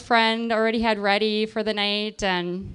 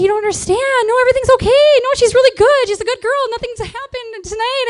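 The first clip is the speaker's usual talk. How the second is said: high, loud, hurried imitated pleading; urgent protest; 13 semitones higher, 10 dB louder than usual. Cop defending the girl to her mom, urgent protest. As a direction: talk over someone screaming, insisting hard. Cop arguing with screaming mother.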